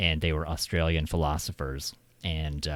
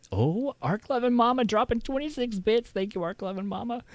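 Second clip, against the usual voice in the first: excited thanks. Real, not acted. Thanking a stream donor.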